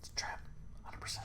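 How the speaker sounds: whispered warning, unvoiced; whispered aside. Real, not acted. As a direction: hiss it under your breath to the table. Aside warning about a trap.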